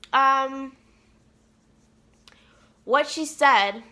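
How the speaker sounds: ordinary talk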